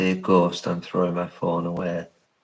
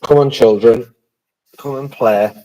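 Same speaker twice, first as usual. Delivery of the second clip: loud, higher call into the dark; calling out to spirits; 4 semitones higher, 12 dB louder than usual. Real, not acted. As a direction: summon the spirits, raise your voice.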